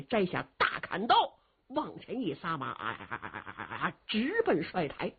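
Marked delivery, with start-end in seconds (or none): 2.7-3.9 low galloping calls. Acted. Riding off, galloping call.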